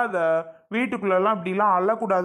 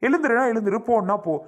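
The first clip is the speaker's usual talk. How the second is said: raised-pitch, impatient commands; angry; 5 semitones higher than usual. Acted. Husband ordering questioning wife to her room, angrily. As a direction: order her off, cutting short every question. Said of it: "ஏன் விக்கி, என்ன ஆச்சு இப்படி கோவப்பட்டுட்டே இருக்கீங்க".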